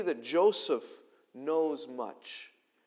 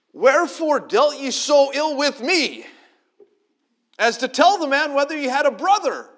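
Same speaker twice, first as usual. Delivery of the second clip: put-on complaining old Israel; 11 semitones higher, 13 dB louder than usual. Old Israel berating his sons, high and loud. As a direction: whine it like a wronged old father.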